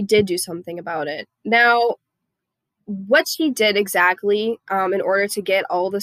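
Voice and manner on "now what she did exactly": ordinary delivery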